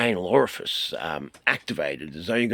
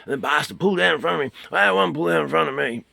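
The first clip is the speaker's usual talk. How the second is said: mock road rage; 9 semitones higher, 8 dB louder, faster than usual. Retelling an angry road incident.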